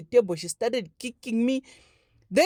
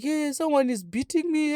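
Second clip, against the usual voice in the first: higher, tearful complaint; mock wail, pitched up; imitated crying; 6 semitones higher than usual. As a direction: act out a child reporting through tears. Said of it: "already I'm crying".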